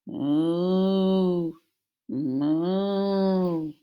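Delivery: loud long lowing moo; cow moo. Retold or imitated. Reading to children, loud drawn-out moo.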